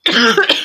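sudden loud choking cough; coughing fit. Real, not acted. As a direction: cough hard after swallowing wrong. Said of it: "ich habe mich grad verschluckt".

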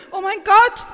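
loud, high cry of shock. Acted. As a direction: exclaim in shocked disbelief. Startled after an explosion. (erschrocken ausgerufen)

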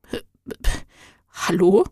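breathless stuttered hello, then a small rise; stammering in awe. A narrator's reading. Girl stammers back at the shining angel, awestruck. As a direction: stumble over the word, struck with wonder. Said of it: "voll Staunen heraus".

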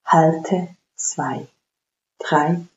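slow, paced breath counting. Acted. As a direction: count each breath calmly and evenly. Counts the box breathing aloud.

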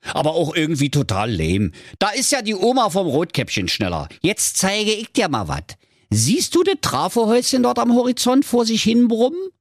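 high, scoffing; cocky web designer. Acted. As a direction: brag and belittle him. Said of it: "sagte da der Lars".

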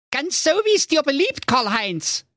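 high sweet ogress voice; dutiful cartoon wife. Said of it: "sprach die Brigitte Menschenfresser". (hohe Stimme der Menschenfresserin)

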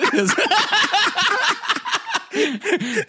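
high-pitched laughing burst; real laughter. Real, not acted. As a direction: crack up at the punchline. Laughs at own story.

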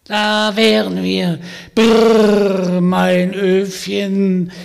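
put-on high, loud croaky old witch voice; croaking witch. Witch inviting Vanya home, croaking and sly. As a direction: play the sly old hag inviting him along. Witch stops her oven.

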